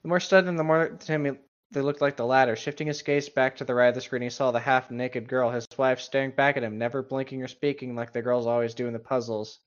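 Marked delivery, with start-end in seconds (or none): none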